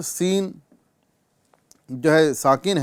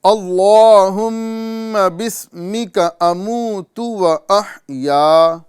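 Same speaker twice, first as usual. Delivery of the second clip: very slow, higher, louder chanted "اللہم بسمک اموت و احیا"; slow recitation; 5 semitones higher, 6 dB louder, much slower than usual. Shown as a demonstration. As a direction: recite each syllable stretched for students to copy. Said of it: "تو پڑھنے کی کوشش کریں ایک مرتبہ".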